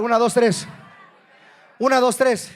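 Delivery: rousing congregation count-in. Shown as a demonstration. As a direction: count them in forcefully. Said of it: "Díganlo más fuerte".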